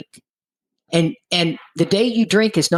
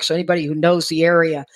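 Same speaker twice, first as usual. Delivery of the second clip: ordinary delivery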